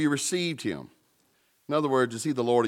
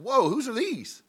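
much higher, startled squeal of disgust; startled whoa; 14 semitones higher than usual. Retold or imitated. Preacher recalls finding his dirty laundry, startled. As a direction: act shocked at the stinky clothes. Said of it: "and I was like".

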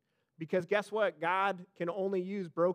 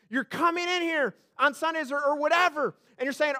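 loud, higher, fervent preaching; rising revival-preacher energy; preaching at full force; 9 semitones higher, 8 dB louder than usual. Acted. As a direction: drive it home to the congregation. Preacher building to a climax.

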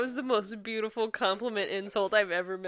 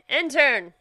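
calling out; 4 semitones higher, 9 dB louder than usual. Pretend. Calling to an imaginary intern.

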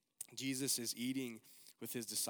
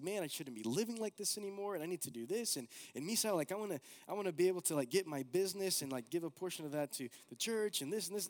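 imitating eager friend; 6 semitones higher, 3 dB louder, faster than usual. Retelling friend's zealous plans.

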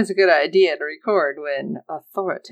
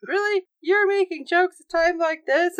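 high-pitched, scandalized questioning; mock incredulous; 11 semitones higher than usual. Podcaster voicing the crew reacting to jokes at such a time, mock incredulous. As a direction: imitate a high, disbelieving reproach. Voicing appalled characters at joke.

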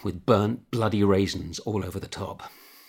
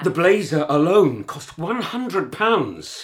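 louder, higher, slow incredulous complaint; indignant outburst; 8 semitones higher, 6 dB louder, slower than usual. Acted. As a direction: stretch out the outrage over the price. Husband quarrels over school spending.

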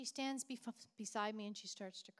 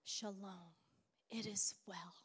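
inner whisper; 3 semitones lower, 4 dB quieter than usual. Retold or imitated. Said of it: "inside, I'm saying".

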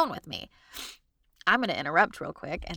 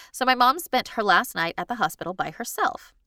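ordinary delivery

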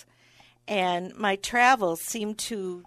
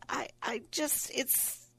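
whispered, much softer, hesitant apology; sheepish whisper; 5 semitones higher, 9 dB quieter than usual. Retold or imitated. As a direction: whisper it so the waiter barely hears. Hushed excuse to offended waiter.

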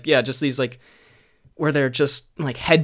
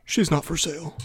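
movie villain voice. Acted. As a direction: drop into a film thug's voice. Acted John Wick movie quote.

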